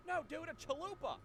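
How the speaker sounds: [name] insists on chalupa; lightly voiced clueless drunk; dopey [name]. [name] correcting.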